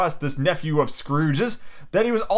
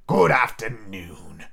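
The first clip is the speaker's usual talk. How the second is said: low, very slow farewell; Scrooge, final dismissal; 5 semitones lower, much slower than usual. Acted. Scrooge shutting out his nephew, low slow dismissal. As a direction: draw it out low and immovable.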